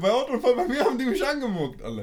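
high, fast, loud disbelief with a laugh; excited laughing outburst. Real, not acted. Friend laughing in disbelief at the polite cop, excited. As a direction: blurt it out laughing, can't believe it.

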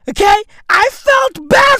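high, loud mock outrage; outraged shout. Real, not acted. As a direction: yell it in exaggerated disbelief. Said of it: "outraged rant".

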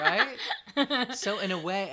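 high, loud, laughing through it. Real, not acted. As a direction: burst out laughing mid-sentence. Both laughing at belly touch.

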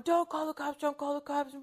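high-pitched imitation of a panicking kid; freaked-out child. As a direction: mimic frantic, repeated pleading. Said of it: "my youngest one was freaking out".

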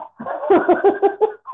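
breathy giggling; unvoiced burst of laughter; giggle. Real, not acted. Laughing at joke.

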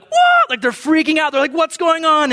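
put-on high, startled cry of the kids. Kids at the retreat, startled when goldfish fly, high and alarmed. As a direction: play the kids' confusion.

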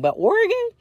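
"Oregon" squeaked way up high in disbelief; incredulous. Real, not acted. Telling friends about the surprise job offer, incredulous high squeak. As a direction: shoot up high, can't believe it.

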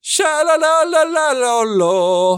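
put-on over-the-top character rant, Italian accent; shrill mock rant. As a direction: screech it like a spoiled brat. Mocking Jared Leto's character.

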